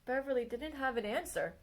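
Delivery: plain reading voice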